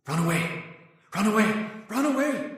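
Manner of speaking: a voice in his head rising to a wail; rising ghostly echo; echoing run away. Acted.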